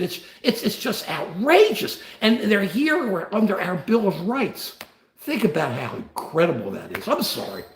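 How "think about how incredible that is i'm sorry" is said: welling up over his country; getting emotional. Real, not acted. Streamer tearing up to viewers, softly emotional. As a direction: voice dropping lower and softer, choked up. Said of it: "I get emotional about it".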